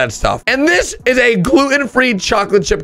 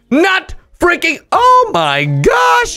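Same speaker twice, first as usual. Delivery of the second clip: shocked; 7 semitones higher, slower than usual. Real, not acted. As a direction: gasp it out in disbelief. Shocked by huge shrimp picture.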